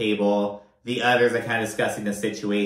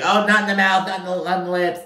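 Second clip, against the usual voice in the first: high, louder imitation of a kiss refusal; mock squeamish protest; 6 semitones higher, 3 dB louder, faster than usual. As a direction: fend off a kiss in a raised mock voice.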